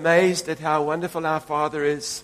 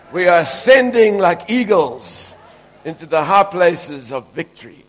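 fiery preaching; 7 dB louder than usual. Real, not acted. Preacher lifting the congregation toward victory, loud and soaring. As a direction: lift them up like eagles.

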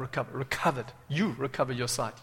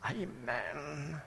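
hushed, soft pious churchgoer; mock religious piety; 12 dB quieter, much slower than usual. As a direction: send up a meek, religious amen. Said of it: "just be religious".

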